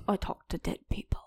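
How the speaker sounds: fast eerie whisper; spooky whisper. Pretend. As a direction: whisper it like a ghost story.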